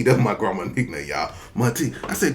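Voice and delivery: normal talking voice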